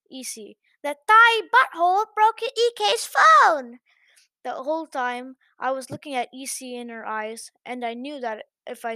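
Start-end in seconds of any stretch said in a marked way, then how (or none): 0.8-3.8 high, loud, slow sneering accusation; spiteful chaperone. Acted. Chaperone accusing the narrator to the headmistress, loud sneer. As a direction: spit out the insult.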